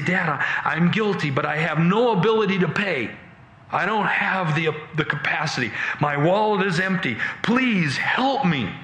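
high, urgent begging; desperate pleading. Acted. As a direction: plead helplessly with Dad, drawing out the last appeal. Son begs father for help.

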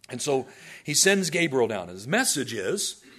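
ordinary delivery

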